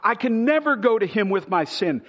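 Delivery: loud, high pleading preacher; impassioned appeal; fervent altar call. Acted. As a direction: call out to the unbeliever in the pews. Appeal to listeners, full force.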